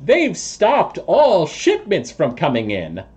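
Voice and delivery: high, deliberate toad voice; earnest toad creature. Acted. Toad person informs party about the guards, high and deliberate.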